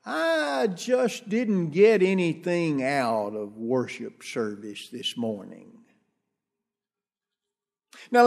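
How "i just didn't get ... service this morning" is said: mock grumble. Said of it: "the old standard for some folks".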